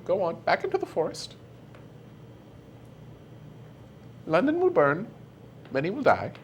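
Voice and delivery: high, airy fairy queen; Fey Queen voice. Acted. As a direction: play the queen, amused and cruel. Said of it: "the Fey Queen looks down at the party and goes".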